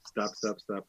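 soft, low stab stab murmur; quiet. Pretend. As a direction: whisper the stabs to yourself. Repeats quiet stabbing mime.

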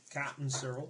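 slow, lightly put-on country accent. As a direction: have a rough go at Somerset. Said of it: "is that the accent you're going for?".

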